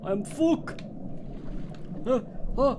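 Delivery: high, slow Klingon growl of "fuck". Acted. Streamer playing the Klingon named Fuck, high Klingon voice. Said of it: "fuck the Klingon".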